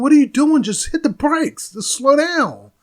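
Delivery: high, loud panicked yelling, slowing at the end; imitating a scream. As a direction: mimic a girlfriend screaming at her boyfriend to brake. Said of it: "screamed at the boyfriend".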